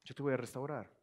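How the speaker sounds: tender promise. Acted. Repeated promise.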